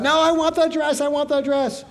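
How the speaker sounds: put-on high, whiny child wailing for a dress; mock tantrum, high and whiny; screaming little girl.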